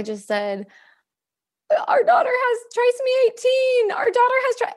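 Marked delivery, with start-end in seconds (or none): 1.9-4.7 retold near-shout, much higher and strained; hysterical. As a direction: cry it out to her husband in panic. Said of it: "and I am hysterical".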